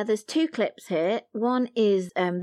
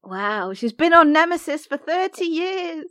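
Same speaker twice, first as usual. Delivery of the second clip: amused exclamation; 8 semitones higher, 6 dB louder than usual. Real, not acted.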